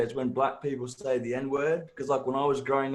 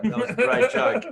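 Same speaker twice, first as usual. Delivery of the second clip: laughing mock praise; 11 semitones higher, 5 dB louder than usual. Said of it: "come back to me like".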